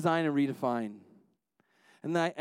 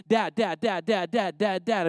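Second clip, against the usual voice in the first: rapid-fire nagging, mimicking a persistent kid; pestering child imitation; 3 semitones higher, 5 dB louder, slower than usual. Preacher imitating his son pestering him for attention, insistent. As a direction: badger Dad, word after word without a break. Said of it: "I mean, that's totally annoying".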